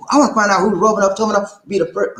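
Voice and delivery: fast, high hype call. Shown as a demonstration. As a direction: rattle it off quick and high. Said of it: "I have to say it slow".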